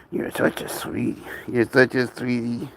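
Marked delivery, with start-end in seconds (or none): 0.1-1.2 soft whisper to the pet. Real, not acted. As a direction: breathe it tenderly to the animal.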